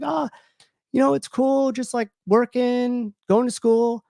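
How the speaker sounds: high, bright put-on casual voice; fake cheerful. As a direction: brush it off with forced breeziness. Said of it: "and I was like".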